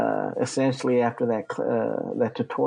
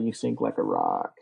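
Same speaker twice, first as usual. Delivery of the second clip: mocking instructors. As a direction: drop low and tease like the swim instructors. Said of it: "as they would tell everybody".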